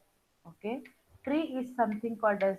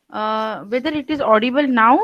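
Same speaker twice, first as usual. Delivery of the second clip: louder; 11 dB louder than usual. Real, not acted. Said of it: "your voice is very low".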